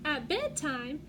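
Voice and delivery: ordinary delivery, nothing marked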